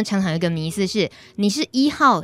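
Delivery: normal talking voice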